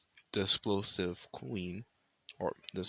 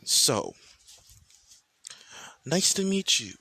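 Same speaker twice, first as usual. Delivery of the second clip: loud, hostile greeting with pauses; pissed off; 4 semitones higher, 7 dB louder, slower than usual. Acted. Michael greeting Riser's queen, loud and pissed. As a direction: spit it out loudly, piece by piece. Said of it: "He went more pissed".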